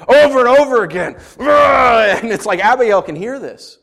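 loud, rising, urgent preaching; preacher shouting David's fury; preaching at full force. Real, not acted. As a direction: hammer home his rage, building.

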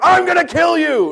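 hammed-up angry parent; mock furious. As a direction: explode with exasperation. Parent's rage after relief.

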